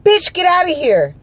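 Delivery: high, loud possessed-woman snarl at her; exorcist outburst. Retold or imitated. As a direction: blow up suddenly, high and loud, imitating the guard. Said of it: "went from regular to fucking exorcist".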